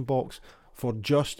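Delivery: slow, rising stress on the word just. Shown as a demonstration. Host reading a magazine advert aloud, stretching out just. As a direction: lean on just, drawing it out and lifting it. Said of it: "I'm highlighting the word just here".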